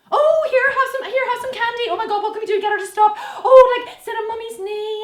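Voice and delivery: high, loud, frantic cooing; fussing mother. Retold or imitated. Mother fussing over a crying daughter, high frantic cooing. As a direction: panic and pamper like a doting mum. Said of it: "with girls, we go".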